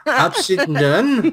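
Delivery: drawn-out words breaking into a laugh; laughing slow try. Pretend. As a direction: stretch it slowly while cracking up. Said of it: "tries to read upside down".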